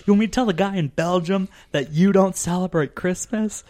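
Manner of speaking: incredulous challenge. Retold or imitated. Telling mom's secret to everyone, mock indignant. Acted exasperated challenge to mom.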